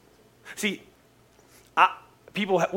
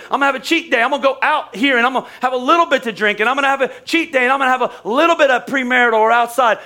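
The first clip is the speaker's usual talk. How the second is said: higher, louder, fast mock believer justifying himself; teasing impression of a cheat-day believer; mock churchgoer; 6 semitones higher, 3 dB louder, faster than usual. Mocks a half-committed believer.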